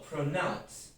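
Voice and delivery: pronounce, said slowly and loud with stress on nounce. Shown as a demonstration. Teacher models verb stress for students: pronounce, slow and loud on nounce. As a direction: stretch out pronounce and lean on nounce.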